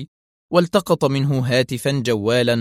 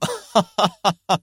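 high staccato gloating laugh; gleeful villain cackle; 9 semitones higher than usual. Acted. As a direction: laugh with wicked delight. Gleeful villain laughs after boasting.

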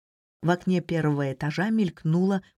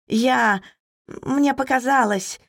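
higher, faltering flustered maid; hesitant, halting and higher-pitched; flustered babble; 7 semitones higher, 3 dB louder, slower than usual. A narrator's reading. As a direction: stammer it out in a high, startled voice. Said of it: "пролепетала она".

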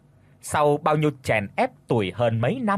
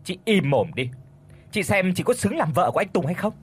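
snapping turnaround; 6 semitones higher than usual. A narrator's reading. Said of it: "lật mặt".

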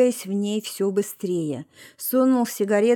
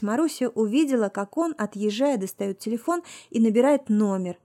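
plain reading voice

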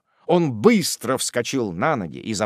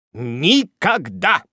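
higher, louder, slow and drawn-out; kneeling Tupman swearing devotion, emphatic; chivalrous vow; 8 semitones higher, 6 dB louder, much slower than usual. A narrator's reading. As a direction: vow it with grand gallantry. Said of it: "был рыцарский ответ".

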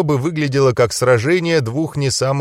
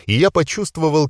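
ordinary delivery

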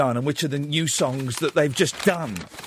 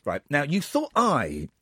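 ordinary talk, nothing marked

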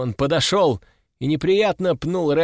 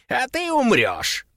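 casual shrug; 8 semitones higher than usual. A narrator's reading. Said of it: "пожал плечами Рэмбо".

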